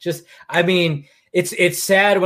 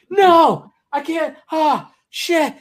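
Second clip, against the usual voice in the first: high dismayed cry; 13 semitones higher, 4 dB louder, much slower than usual. Retold or imitated. Customer at Blockbuster finding the box gone, high dismayed yelp. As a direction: pitch jumps way up in mock dismay. Reenacting missing movie frustration.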